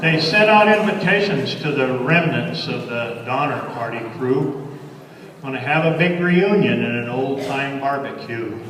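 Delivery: lively cowboy verse, lilting; cowboy poem recitation. Acted. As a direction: recite it like a campfire cowboy poet.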